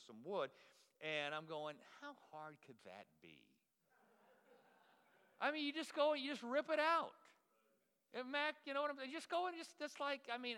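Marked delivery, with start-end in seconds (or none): 2.0-3.4 low, hushed rhetorical musing to himself; naive musing. Retold or imitated. Pastor sizing up the floor job, naively musing. 5.4-10.6 high, loud, breezy overconfidence; cocky bravado. Retold or imitated. Pastor bragging he can rip out the floor, cocky bravado.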